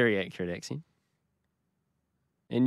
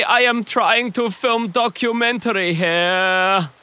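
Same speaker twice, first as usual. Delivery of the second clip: put-on slow, loud, higher German accent; hammed-up German filmmaker; 7 semitones higher, 6 dB louder, slower than usual. German cameraman scolding the noisy pair, in a thick hammed-up German accent. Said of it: "this very accurate accent".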